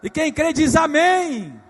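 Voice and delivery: loud, slow call for amen; rally the congregation, drawn out; preaching call. Real, not acted. Pastor to church, loud slow call for amen.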